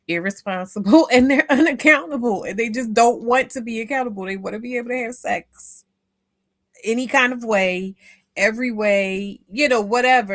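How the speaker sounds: loud rant. Real, not acted. Host venting about men who dodge responsibility, loud heated rant. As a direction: vent it hard and loud.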